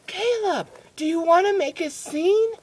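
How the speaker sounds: high, sharp imitation of mom; mock motherly threat; scolding mom. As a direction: warn the kid in a put-on mother voice. Mom warns him during tantrum.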